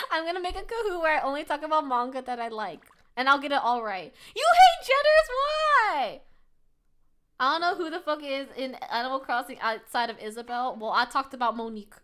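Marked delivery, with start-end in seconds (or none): 4.3-6.2 high, loud incredulous question; shocked disbelief. Acted. Streamer voicing shock that anyone hates Jitters, incredulous. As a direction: cry out as if scandalised.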